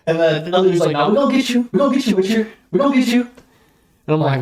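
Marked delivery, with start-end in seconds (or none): 0.9-3.3 much higher, sing-song menace; taunting threat. Retold or imitated. Rival's cousin threatening the narrator, taunting threat.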